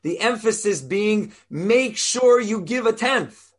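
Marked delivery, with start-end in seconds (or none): 1.5-3.5 slow, higher, emphatic command; with conviction. Acted. Rabbi voices the Torah commanding a tithe, with slow conviction. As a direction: say it firmly and slowly, leaning on each word. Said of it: "Says it with conviction".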